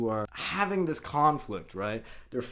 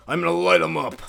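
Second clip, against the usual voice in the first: sudden loud, raised-pitch outburst; hammed-up vigilante overreaction; mock-furious Punisher; 5 semitones higher, 8 dB louder than usual. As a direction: explode over a petty crime.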